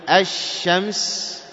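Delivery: "الشمس" as ash-shams, with a long held sh; lam merged into shin. Shown as a demonstration. Teacher showing why it is a sun letter, merged lam in "الشمس". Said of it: "تدغم هذه اللام في ماذا؟ في الشين".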